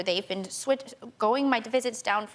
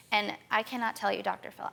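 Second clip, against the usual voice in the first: ordinary talk, nothing marked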